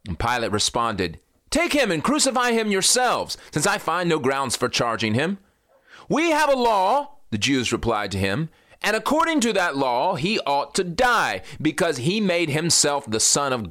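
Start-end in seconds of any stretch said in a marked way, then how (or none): 1.5-5.4 much higher, fed-up retort; exasperated retort, pitched high; exasperated Pilate. Acted. Pilate answering the crowd. 6.1-13.7 much higher, pressing voice; high, insistent demand; insistent accusers. Acted.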